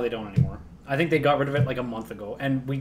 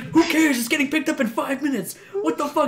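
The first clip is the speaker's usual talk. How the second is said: very high, raised mock outrage; hammed-up exasperation, pitched way up; exasperated retort; 12 semitones higher than usual. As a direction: act out an incredulous comeback in a squabble. Acted quarrel, dog walker retorts.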